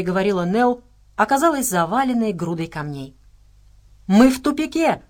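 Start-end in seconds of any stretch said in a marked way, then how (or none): 4.1-5.0 higher, raised-voice exclamation, mild growl at most; understated narrated growl of alarm. A narrator's reading. Медвежонок telling the group they are trapped, mildly growled. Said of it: "прорычал Медвежонок".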